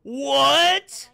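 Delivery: loud, high, drawn-out cry of shock; shocked disbelief. Real, not acted. Viewer finds out that's Beetlejuice, loud shocked disbelief. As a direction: blurt it out high and loud, stunned. Shocked to recognise a changed character.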